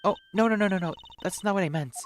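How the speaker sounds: flustered run of no's; hurried denial. Acted. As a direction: rush to reassure. Character quickly reassures.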